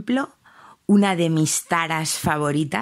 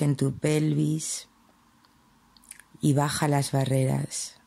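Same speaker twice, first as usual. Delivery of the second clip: guided meditation; 2 semitones lower than usual. Acted. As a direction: ease the listener down gently, phrase by phrase.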